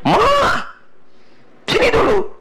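mock call from the husband. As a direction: call your wife over from the next room. Husband calls wife to watch football.

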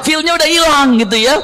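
high, giggling through the words; laughing along. Real, not acted. Preacher to the mothers about the man who lost his tears, laughing. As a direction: laugh at how the crying mood vanished. Mocking the man's lost feeling.

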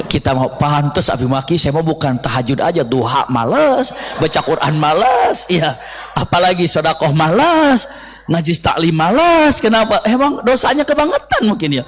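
ordinary talk, nothing marked

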